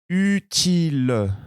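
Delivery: utile stretched slowly, high; slow model word. Shown as a demonstration. Teacher modelling dictation, slow high utile. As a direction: draw out utile for listeners. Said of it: "Écoutez bien".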